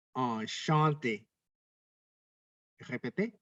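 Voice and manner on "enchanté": "enchanté" said slowly, nasal "an" vowels; slow model word. Shown as a demonstration. French teacher models "enchanté" slowly for students. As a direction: spell out "enchanté" slowly for the class. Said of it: "Contohnya adalah".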